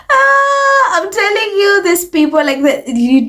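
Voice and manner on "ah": laughing wail. Real, not acted. Exasperated laughing cry at journalism.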